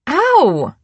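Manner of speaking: sharp, high, loud yelp of pain; shouted ow. A narrator's reading. Julie hit in the arm by her twin, loud yelp. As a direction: yelp it out after the smack. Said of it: "she shouted".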